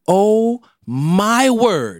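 slow, drawn-out shocked gasp of pain. Retold or imitated. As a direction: recoil like the bite just burned you. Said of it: "I was like".

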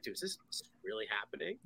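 very soft, low, rushed aside; hushed freak-out. Retold or imitated. Freaking out to Rick during the escort, hushed and hurried. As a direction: panic quietly under your breath.

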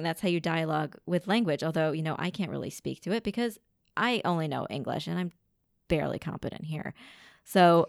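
usual speaking voice

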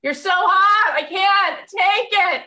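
overwhelmed squeal of a starstruck fan; swooning gush. Retold or imitated. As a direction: lose it completely over the hot guest. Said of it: "One more time".

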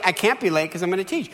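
ordinary talk

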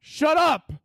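high, slow shouted shut up; annoyed yell. Pretend. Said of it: "and I'm like".

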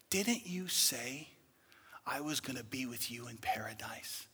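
hushed, unvoiced whisper; whispered question. Thief asking Jesus about paradise, whispered. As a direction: let it fall to a whisper.

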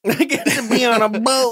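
high, loud laughing echo. Retold or imitated. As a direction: laugh through the line. Echoes line laughing.

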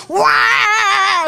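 loud, high mock bawl of a baby boy; imitated baby yell. Father imitates his son crying loudly, hammed-up bawl. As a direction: bellow it like a howling infant. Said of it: "mi hijo es".

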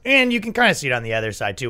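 ordinary talk, nothing marked

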